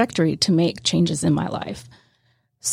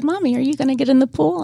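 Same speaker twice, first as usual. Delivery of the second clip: put-on higher, faster child's voice; little daughter's voice; 7 semitones higher, faster than usual. Daughter asking mommy at the pool, high and fast. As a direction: perk up like a little girl.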